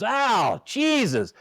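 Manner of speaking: high, drawn-out mock exasperation; retold dugout complaint. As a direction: imitate a coach griping at a bad call. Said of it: "like that".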